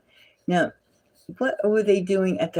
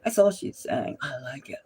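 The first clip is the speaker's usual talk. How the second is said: mock breathy purr; 7 dB quieter than usual. Mocking her repeated line.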